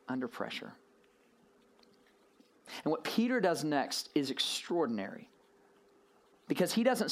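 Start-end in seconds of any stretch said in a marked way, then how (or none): 0.1-0.7 hushed echo. Acted. Dramatic echo of the phrase.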